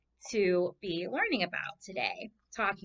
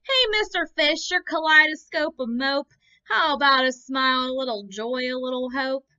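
higher, louder nudging squid; pushy squid; 4 semitones higher, 8 dB louder than usual. Acted. Squid prodding the fish to smile, higher and louder. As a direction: needle the fish with a grin.

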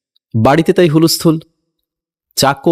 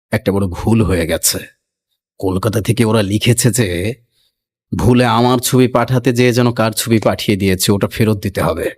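master's voice; 6 semitones lower than usual. A narrator's reading. Master tells the household the portrait was wrong, lower and grave. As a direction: announce the mistake like a grave landlord. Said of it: "এক একটা character আছে এখানে".

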